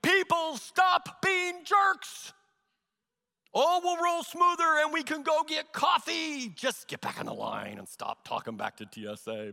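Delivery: hammed-up plea to the grumbling line; mock exclaim. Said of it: "so tempted to exclaim".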